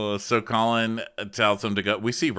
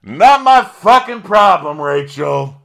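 very loud, high, drawn-out shout; mock drunken yell; 10 semitones higher, 14 dB louder, slower than usual. Drunk guest refusing to back off, imitated yelling. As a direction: bellow it slowly, imitating a belligerent drunk.

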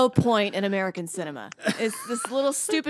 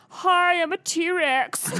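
silly T-Rex voice; 8 semitones higher, 3 dB louder, much slower than usual. Pretend. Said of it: "what if they were like".

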